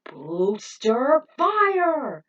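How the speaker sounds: long drawn-out battle cry, loud and high; anime attack call. Acted. Falcon showing Rick his secret move, drawn-out anime shout. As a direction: stretch the move name like an anime hero. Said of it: "a secret technique known as the booster fire".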